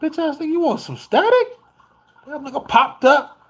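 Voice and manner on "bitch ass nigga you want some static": high, challenging mock voice; a tough dog picking a fight, mock high challenge; dog voiced as a tough guy. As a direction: taunt him in the dog's voice. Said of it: "he looked at me kinda like".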